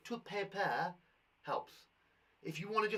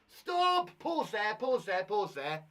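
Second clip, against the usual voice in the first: sudden loud high call to stop; loud, high teacher call to halt the reading; 4 semitones higher, 9 dB louder, much slower than usual. Shown as a demonstration. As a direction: shout the stop to the class. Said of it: "I'll pause at the correct places".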